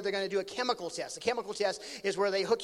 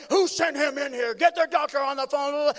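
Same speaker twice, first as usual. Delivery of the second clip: loud, high outraged ordering; hammed-up furious barking of orders; angry doctor's shout; 6 semitones higher, 9 dB louder than usual. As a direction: mimic a doctor demanding answers. Repeats the doctor's angry demand.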